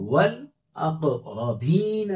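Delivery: वल अक़रबीन stretched very slowly, with a full heavy रा; drawn-out careful read of वल अक़रबीन; slow Quranic reading. Shown as a demonstration. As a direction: recite वल अक़रबीन slowly, the रा thick. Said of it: "या साकिना से पहले जेर".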